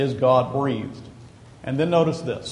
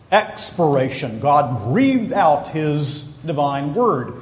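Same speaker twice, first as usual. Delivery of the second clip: ordinary delivery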